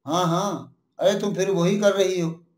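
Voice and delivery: ordinary delivery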